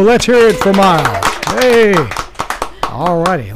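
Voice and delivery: normal talking voice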